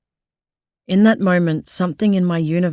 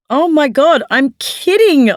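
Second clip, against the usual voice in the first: much higher and louder, slow on kidding; exasperated outburst; 9 semitones higher, 6 dB louder than usual. A narrator's reading. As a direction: throw your arms up, fed up. Throwing arms up in exasperation.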